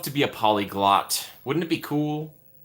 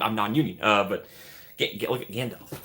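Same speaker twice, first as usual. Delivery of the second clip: usual speaking voice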